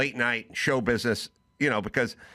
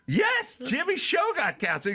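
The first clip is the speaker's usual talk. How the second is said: very high, exasperated insistence; exasperated yell. Retold or imitated. To Mike, who hadn't heard, very high exasperated yes. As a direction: shout it up high in disbelief. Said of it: "I go".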